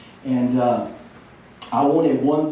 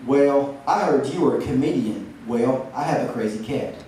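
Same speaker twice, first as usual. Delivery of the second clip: ordinary talk, nothing marked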